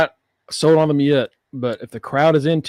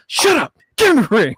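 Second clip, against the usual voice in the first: high, raised-voice imitation of an order; barked order; 8 semitones higher, 4 dB louder than usual. Imitating Chase ordering his team into the ring, angry shout. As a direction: cut them off with a sharp shout.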